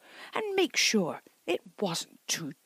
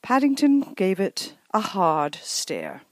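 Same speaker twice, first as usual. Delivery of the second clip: ordinary delivery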